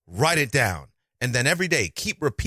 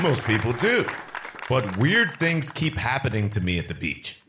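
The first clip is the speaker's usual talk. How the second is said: normal talking voice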